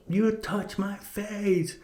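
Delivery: slow, high-pitched indignant bully; mock outrage. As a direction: imitate an affronted man, drawn out and high. Slapped bully's outraged reply, retold.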